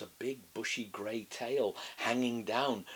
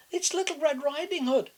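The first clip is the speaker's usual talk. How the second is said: put-on high, bright child calling; little girl voice; 6 dB louder than usual. A narrator's reading. Girl at grandma's door, high child's call.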